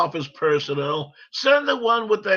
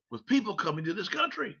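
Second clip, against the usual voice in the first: ordinary talk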